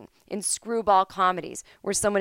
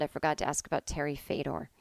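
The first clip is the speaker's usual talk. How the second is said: usual speaking voice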